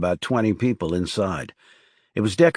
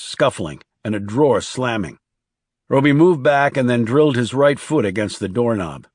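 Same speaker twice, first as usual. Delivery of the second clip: ordinary delivery, nothing marked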